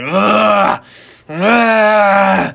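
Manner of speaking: loud, drawn-out grunting growl; imitated grunting growl. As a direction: growl like a caged wrestler pacing. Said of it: "grunting, growling to himself".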